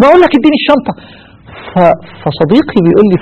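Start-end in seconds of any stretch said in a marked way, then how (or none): 0.0-0.9 much higher, snapping for the briefcase; angry demand. Retold or imitated. Dying passenger snapping at the friend for his briefcase, high and angry. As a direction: lose patience and demand it high. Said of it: "يتعصب عليه".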